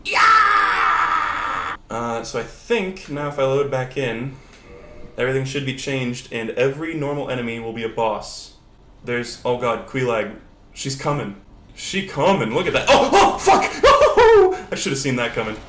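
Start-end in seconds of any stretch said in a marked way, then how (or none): 0.0-1.8 very high, drawn-out shout; whooping battle cry; wild yell. Pretend. Yells while leaping in game. 12.9-14.7 high, loud yelps breaking into laughter; real shriek of surprised laughter; startled laughing outburst. Real, not acted. Ambushed in game, cracking up.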